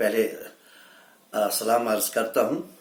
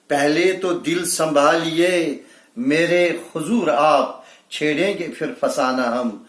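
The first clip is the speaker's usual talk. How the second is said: lifted, emphatic ghazal opening easing down at the close; mushaira-style recital; poetry recitation; 4 semitones higher, 5 dB louder, slower than usual. Acted. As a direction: declaim the couplet to the audience. Repeats the couplet with lift.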